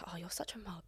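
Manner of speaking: hushed, teasing whisper; whispered mock insult. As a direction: breathe it like a jab. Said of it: "it's used more like".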